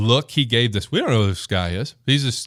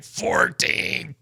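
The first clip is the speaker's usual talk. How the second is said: much lower strained grunt of a number; straining; 10 semitones lower, 4 dB quieter than usual. Retold or imitated. Lifter barely finishing the fourteenth rep, straining. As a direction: squeeze it out like the last rep. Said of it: "you know, barely".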